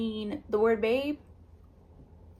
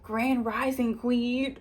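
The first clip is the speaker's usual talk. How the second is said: corny text-guy voice; slower than usual. Retold or imitated. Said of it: "dudes who would text you like".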